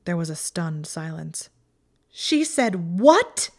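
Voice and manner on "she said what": slow, loud, high cry of disbelief; high-pitched outraged shriek; shrieked question. A narrator's reading. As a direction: shriek it, drawing out the words. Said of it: "Hermione shrieked the question".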